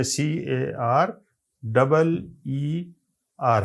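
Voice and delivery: slow, deliberate letter-by-letter spelling; spelled letter by letter. Shown as a demonstration. Teacher slowly spelling a word out for students. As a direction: spell each letter out for the class. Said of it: "تو آپ دیکھیں گے".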